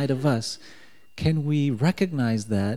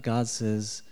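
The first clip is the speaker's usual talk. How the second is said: usual speaking voice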